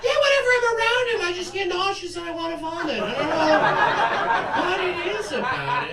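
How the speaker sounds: prissy mock of her gushing; mock girl. Comic mocking the girl who calls him intoxicating, high and fast.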